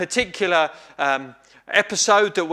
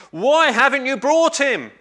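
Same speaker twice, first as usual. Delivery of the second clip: impatient demand; 8 semitones higher, 4 dB louder than usual. Acted. Leaders demand of the officers.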